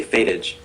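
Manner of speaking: Fatage, opening like fate; Fatage as FAY-tij. Shown as a demonstration. Giving a preferred pronunciation of the name, Fatage as FAY-tij. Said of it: "I'd say".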